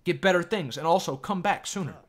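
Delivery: high, loud mock-Chinese accent; put-on Chinese accent. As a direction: sales push in a caricatured Chinese accent. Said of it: "I should be talking like this".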